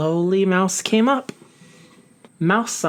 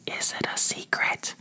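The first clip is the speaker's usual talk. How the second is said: very soft voiceless whisper; whispered back; 10 dB quieter than usual. Acted. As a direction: breathe the question back. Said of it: "Snake whispered back".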